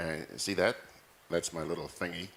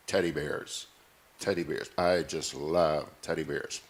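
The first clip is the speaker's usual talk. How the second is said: usual speaking voice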